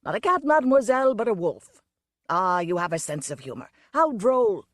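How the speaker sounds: smooth it over with hearty cheer; jovial peacemaker. Acted. Circus owner jollies the wolf woman, hearty. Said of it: "in a conciliatory tone".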